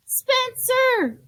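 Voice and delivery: very high, loud scream of his name; a furious mother discovering the mess. Acted. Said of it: "she screamed".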